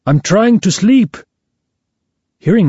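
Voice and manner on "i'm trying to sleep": put-on high, complaining Italian-accented voice. A narrator's reading. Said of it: "with his funny Italian accent".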